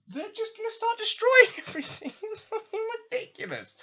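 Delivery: high squeaky laughing outburst; cracking up while mocking the plan; laughing rant. Real, not acted. Ranting to viewers about the plan, laughing.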